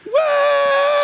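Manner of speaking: high shrieked what; shocked shout. Real, not acted. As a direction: yelp it in disbelief. Shock at the news.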